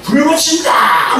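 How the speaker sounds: high, long-drawn yell; shouted threat. Acted. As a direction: holler the threat like a raging drunk. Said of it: "근데 기름 붓고".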